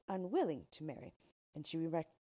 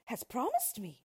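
startled princess cutting in; higher-pitched disbelieving question; incredulous interruption; 5 semitones higher, 4 dB louder than usual. A narrator's reading. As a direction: throw it back in disbelief. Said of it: "interrupted the princess".

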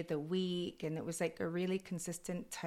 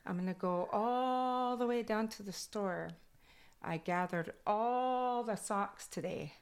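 Kwakwala accent; 4 semitones higher than usual. Shown as a demonstration. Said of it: "my accent from Kwakwala goes into English".

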